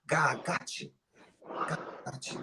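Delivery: soft, low, fading to a whisper; reassuring a friend, whispered softly; tender reassurance. Real, not acted. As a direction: hush it like a comfort. Comforting, repeated softly.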